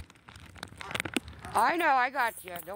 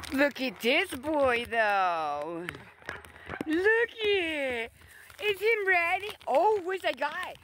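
much higher teasing sing-song; 9 semitones higher, slower than usual. Real, not acted. Owner teasing his eager dog with chicken, high baby talk.

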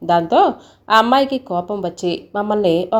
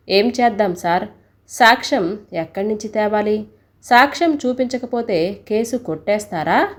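ordinary delivery, nothing marked

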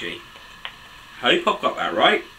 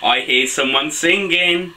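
plain reading voice

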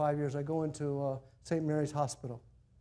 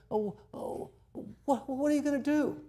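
caught-out, much higher voice; flustered dodge; 10 semitones higher than usual. Retold or imitated. Sick man flustered when the pastor catches him out. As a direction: stammer like you've been caught.

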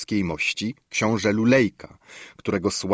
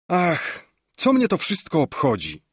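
high, agitated outburst, pitched up; deeply agitated; 8 semitones higher than usual. A narrator's reading. Lulejka flinging away the news in agitation, high agitated cry. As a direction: cry it out in distress, voice jumping up. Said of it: "z oznakami najgłębszego wzburzenia".

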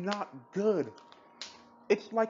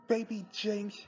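ordinary delivery

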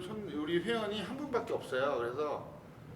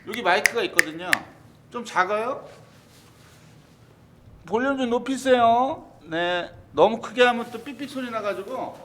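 louder, projecting to the back of the room; speaking up; 3 semitones higher, 8 dB louder than usual. Real, not acted. Streamer speaking up so viewers can hear, louder. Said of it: "제가 목소리를 좀 크게 하겠습니다".

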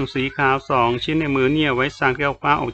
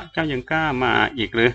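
ordinary delivery

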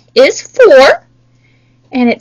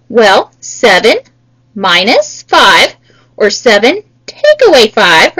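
slow and deliberate; 5 semitones lower, slower than usual. Shown as a demonstration. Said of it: "teacher stresses the problem".

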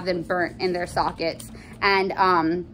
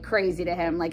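normal talking voice

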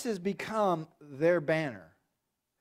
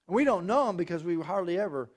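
normal talking voice

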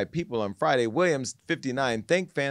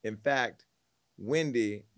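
normal talking voice

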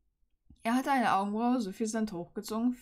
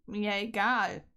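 flat dismissive shrug in the voice; unmoved; slower than usual. Acted. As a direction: brush her off coolly. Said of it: "entgegnet ihr der düstere Kerkerbewohner ungerührt".

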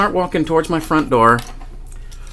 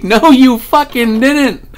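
much higher and louder, slow, laughing; hollering; 12 semitones higher, 7 dB louder, slower than usual. Retold or imitated. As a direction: crow it at him with delight. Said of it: "hooting and hollering I was getting".

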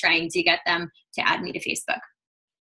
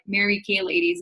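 normal talking voice

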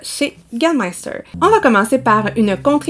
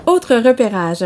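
normal talking voice

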